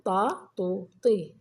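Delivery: طا، طو، طي as separate syllables, a then u then i; three short vowels in turn. Shown as a demonstration. Teacher summing up the three vowels, طا، طو، طي said separately. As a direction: step through طا، طو، طي one by one. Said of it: "بالحركات الفتح والضم والكسر".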